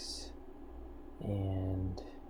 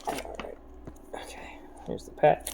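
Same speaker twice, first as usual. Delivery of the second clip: whispering; 5 semitones higher than usual. Real, not acted. Whispering to cat.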